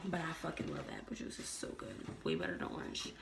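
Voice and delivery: hushed, very soft confession; whispered aside. Real, not acted. Telling viewers about loving apple juice, whispered. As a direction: whisper it like a guilty secret.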